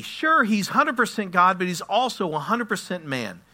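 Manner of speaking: loud, high sermon delivery; full-force preaching. Real, not acted. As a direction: drive the point home at preaching pitch.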